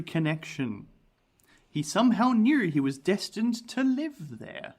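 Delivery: slow, dramatic narration with swooping emphasis; story narration. A narrator's reading. Host tells of Reginald's eerie connection, dramatic story narration. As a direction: tell it like a ghostly history, stretching key words.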